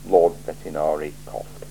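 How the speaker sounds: usual speaking voice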